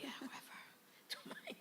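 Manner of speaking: very soft, whispery brush-off; muttered indifference. Retold or imitated. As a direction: mutter it away under your breath. Shrugging off psychic's dire predictions.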